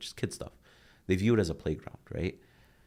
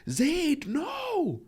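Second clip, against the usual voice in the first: high, loud drawn-out mock shout; hammed-up big-brother outburst; imitated scolding child; 5 dB louder than usual. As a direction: yell at a little brother in alarm. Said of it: "his young brother comes and knocks over something".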